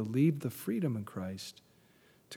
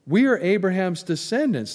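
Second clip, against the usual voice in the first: proud claim; 6 semitones higher, 7 dB louder than usual. Retold or imitated.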